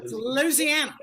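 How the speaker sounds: "Louisiana" said as LOO-zee-AN-uh, like spelled LOOZIANA; Louisiana, local way. Shown as a demonstration. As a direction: lean into the LOOZ at the start of "Louisiana". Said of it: "I spell it L O O Z I A N A".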